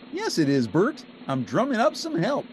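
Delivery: high, bright Muppet Ernie voice; cheerful Ernie, pitched well up. A narrator's reading. Ernie answering Bert, in a high cheerful voice.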